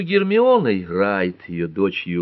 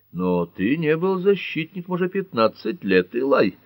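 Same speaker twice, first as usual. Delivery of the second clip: higher, hesitant objection; mildly sceptical, raised pitch; doubtful; 7 semitones higher than usual. A narrator's reading. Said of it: "с сомнением произнёс Джон Ф".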